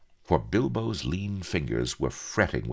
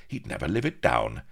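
plain reading voice

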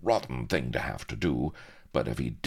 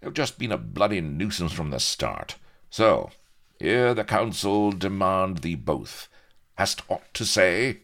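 mildly exasperated pirate judge, rising to a louder demand; lightly voiced, put the formal question to the condemned; pirate leader passing judgement; 7 semitones higher, 5 dB louder than usual. Pirate leader challenges two prisoners, mild exasperation.

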